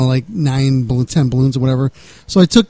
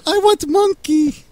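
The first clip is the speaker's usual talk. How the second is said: put-on much higher, slow, childlike pleading; mock broken-English girl; 12 semitones higher, much slower than usual. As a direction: beg for it like a little girl. Teller voices the woman's request.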